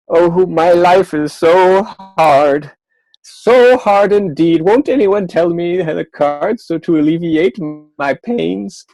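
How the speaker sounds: a mock wail of woe from the queen; queen pleading. Magician voicing the queen begging for pity.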